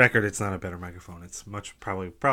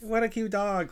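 very high cooing squeal; squeaky baby-talk voice; 12 semitones higher than usual. Retold or imitated. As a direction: coo like talking to a puppy. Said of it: "with their squeaky voices".

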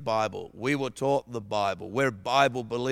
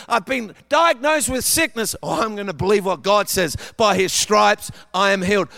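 higher, louder preaching; 6 semitones higher, 8 dB louder than usual. Acted. Preacher urging the congregation to believe, full-force proclamation. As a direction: preach it with rising fire.

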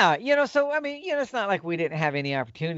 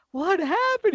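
high concerned question; slower than usual. Retold or imitated. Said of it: "I said".

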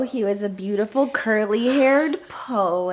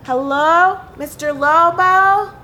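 tentative, higher hail; calling out; 4 semitones higher, 4 dB louder than usual. Acted. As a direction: call through an open window. Calling into a stranger's open window.

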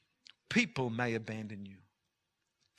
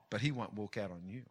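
soft reassurance; 4 semitones lower, 5 dB quieter, faster than usual. Acted. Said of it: "contrast loud and soft lines".

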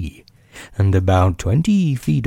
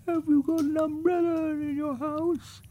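tiny, very high, soft bear voice; hushed little toy bear; Winnie the Pooh; 10 dB quieter than usual. A narrator's reading. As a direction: call down softly in a high, thin voice.

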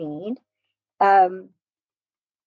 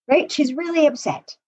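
usual speaking voice

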